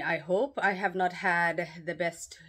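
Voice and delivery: ordinary talk, nothing marked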